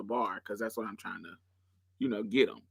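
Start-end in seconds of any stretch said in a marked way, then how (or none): none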